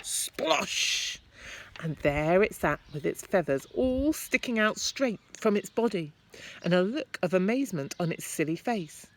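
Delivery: ordinary delivery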